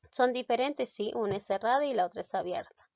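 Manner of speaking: ordinary talk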